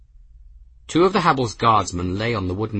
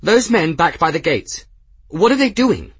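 high, loud alarmed query; raised, urgent voice of a lookout; urgent question; 9 semitones higher, 7 dB louder than usual. A narrator's reading. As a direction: call out in rising alarm. Gwen spots men at the gates.

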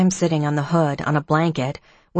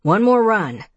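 loud, higher coach's push; loud coaching call; 5 semitones higher, 4 dB louder than usual. A narrator's reading. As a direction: urge him on like a coach. Coach father urging his son.

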